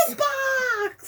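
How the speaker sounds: high, slow, drawn-out wail; a theatrical echo of the famous scene; hammed-up movie cry. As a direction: stretch it out in mock anguish. Both echo the movie quote.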